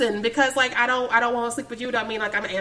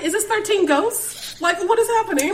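incredulous; 9 semitones higher, 3 dB louder than usual. Real, not acted. Laughing disbelief at the house.

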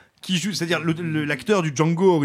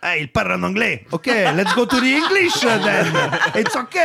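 mock priest laughing; 4 semitones higher than usual. Host mocks the film's priest switching to English, laughing and high. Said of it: "ils font".

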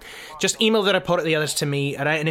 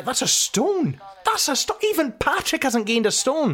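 shocked; 10 semitones higher than usual. Real, not acted. Shocked at weight gain.

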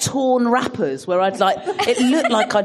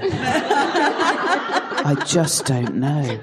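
put-on slow toddler voice, lightly done; much slower than usual. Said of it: "he goes".

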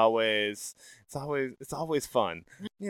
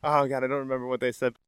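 normal talking voice

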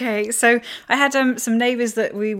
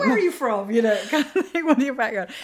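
mock posh inquiry; 4 semitones higher, 5 dB louder than usual. Mimicking posh questioner.

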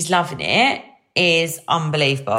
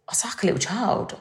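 whisper; 2 semitones higher, 5 dB quieter than usual. Real, not acted. Confiding aside about herself.

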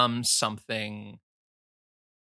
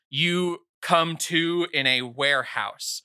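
ordinary talk

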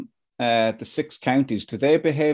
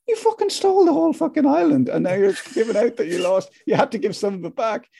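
much higher, indignant rant; mock outraged retort; 11 semitones higher than usual. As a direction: play the exasperated listener, shrill with disbelief. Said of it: "And you're going".

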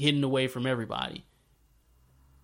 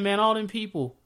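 higher, aggrieved prophet; Elijah, complaining; 8 semitones higher than usual. Retold or imitated. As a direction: whine it up high to God.

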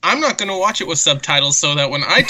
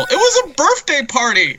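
mock correction; 10 semitones higher than usual. Mock reveal in misunderstood scene bit.

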